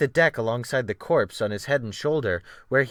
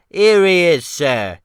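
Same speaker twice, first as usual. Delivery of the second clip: cockney cook; 6 semitones higher, 10 dB louder, slower than usual. Acted. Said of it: "The Cockney popped out".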